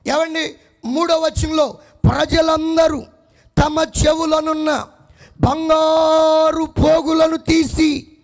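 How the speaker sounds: loud, slow, drawn-out reading; preaching scripture. Acted. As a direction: proclaim the verse with force. Reading scripture at full force. (బలంగా బిగ్గరగా వాక్య పఠనం)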